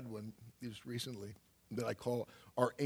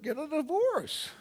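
punchline; 4 dB louder than usual. Retold or imitated. Punchline self-advice.

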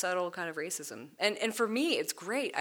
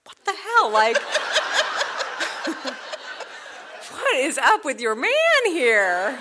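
high, loud mock outrage dissolving into laughter; playing Moses amazed at the squeamish husband, laughing; laughing disbelief; 10 semitones higher, 11 dB louder, slower than usual. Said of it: "looks at me and is like".

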